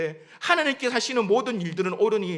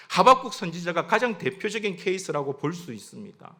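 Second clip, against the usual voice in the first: usual speaking voice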